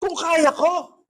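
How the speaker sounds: high, soft affronted echo; offended Lord. Retold or imitated. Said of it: "Parang na-offend si Lord eh". (parang na-offend, mataas at mahina)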